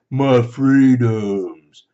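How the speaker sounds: loud, very drawn-out mock complaint; mocked passive-aggressive whiner. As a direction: stretch it out like a whining protester.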